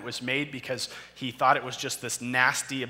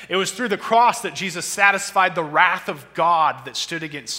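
louder and higher, building to a peak; preaching at full force; 5 semitones higher, 10 dB louder than usual. Acted. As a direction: preach it, rising with every line. Sermon climax on the cross.

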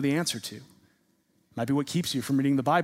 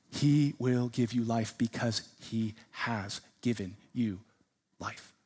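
slow, hushed assurance; solemn preaching; 4 semitones lower, 5 dB quieter, slower than usual. Real, not acted. Preacher assuring his church of given life, solemn hushed preaching. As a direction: bring it down soft and let each word land.

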